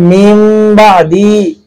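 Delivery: মিম বাদি with the নুন turned into a ম, slow; iqlab n to m. Shown as a demonstration. Teacher shows Quran readers how নুন turns to মিম. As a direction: say মিম বাদি slowly, the ন sounding as ম. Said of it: "এটা কলব হওয়ার কারণে".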